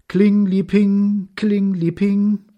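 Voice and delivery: high, drawn-out ringing syllables; bell imitation. A narrator's reading. (hoher, gedehnter Glöckchenklang)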